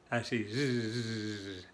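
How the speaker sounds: slow drawn-out buzzing z; teacher buzzes like a bee. Shown as a demonstration. Long bee buzz z. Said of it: "La abeja viene así".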